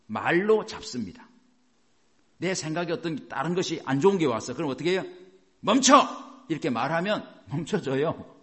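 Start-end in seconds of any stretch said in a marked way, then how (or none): 5.6-6.0 loud, high shout to stop; sharp command. Acted. Preacher showing congregation how to halt bad thoughts, commanding shout. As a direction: halt a bad thought with one forceful cry.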